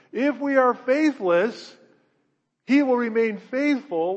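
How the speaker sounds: slow, loud and high, each word driven home; preaching emphasis. Real, not acted. Preacher driving the key verse home to the church, slow forceful proclamation. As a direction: proclaim it slowly at full force.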